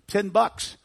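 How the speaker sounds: high, loud sudden blurt; spouted out. Retold or imitated. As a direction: blurt it like a boy naming his price. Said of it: "he spouted the words".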